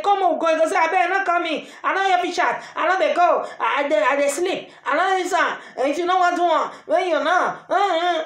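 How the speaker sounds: lightly voiced sleepy excuses, ending in a laugh; acted reluctant excuse. Woman acting out someone who refuses to get up, mild pleading. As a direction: play the one begging to be left to sleep, a touch of whine.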